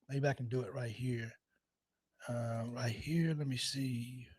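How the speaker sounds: muttering to himself. Real, not acted. Fiddling with the stream, muttering.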